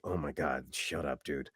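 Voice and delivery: low, hushed imitation; embarrassed undertone. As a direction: say it low, under your breath. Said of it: "I think were embarrassed".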